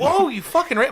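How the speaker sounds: hammed-up scandalized reaction; mock outrage. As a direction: burst out as if appalled, then break off. Acting shocked bystander accusing them.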